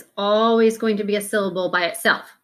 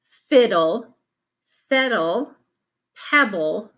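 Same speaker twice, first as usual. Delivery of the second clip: normal talking voice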